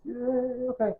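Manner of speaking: high, soft drawn-out cheer; tiny high-pitched yay; small cheer. Real, not acted. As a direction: cheer quietly in a high little voice. Cheers at his marriage license.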